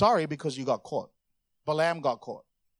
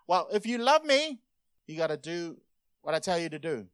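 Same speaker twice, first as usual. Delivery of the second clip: bossy demand. Acted. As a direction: read it as a demanding order. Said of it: "we can read this one way, right?".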